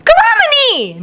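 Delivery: high-pitched cry of a name; sudden high exclamation. A narrator's reading. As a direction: call the name out, pitch soaring. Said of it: "Naruto cries".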